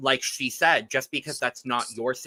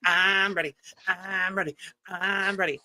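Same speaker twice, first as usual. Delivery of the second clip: high, eager cartoon sponge voice; squeaky SpongeBob chant, high and bouncy; 6 semitones higher, 5 dB louder than usual. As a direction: chirp it like an overexcited cartoon sponge. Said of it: "It felt like, it felt like SpongeBob".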